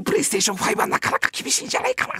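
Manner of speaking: high, soft and quick letdown; gentle refusal. Retold or imitated. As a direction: let the kid down gently. (優しく断るサンタ)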